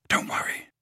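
soft urgent whisper; whispered reassurance. Acted. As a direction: whisper to calm him. Harry whispering comfort.

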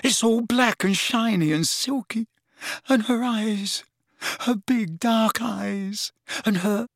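high, dreamy rapture; breathless. A narrator's reading. Lovesick lad raving about his beloved, breathless. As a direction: swoon slowly over each feature. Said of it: "repeated Ron breathlessly".

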